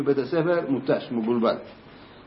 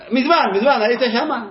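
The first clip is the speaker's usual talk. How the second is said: insistent pupil; 9 semitones higher, 3 dB louder than usual. Retold or imitated. Pupil presses again.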